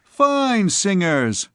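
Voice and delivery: lightly voiced sarcastic student; mock praise. A narrator's reading. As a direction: sneer at the singers. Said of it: "replied the other".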